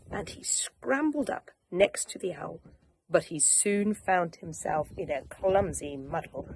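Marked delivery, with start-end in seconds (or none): none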